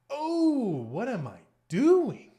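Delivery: loud, high wail of dismay; horrified outcry. Real, not acted. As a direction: cry out in disbelief, drawn out slowly. Blunders, cries out at self.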